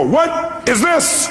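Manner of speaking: mock exasperated shout. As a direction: stretch it way out like catching someone out. Friend pulls salt from shelf.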